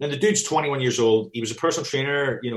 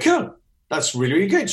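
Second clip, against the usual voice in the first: a high, bright burst of praise for the client; mock-impressed praise; 6 semitones higher than usual. Praising Adam's income.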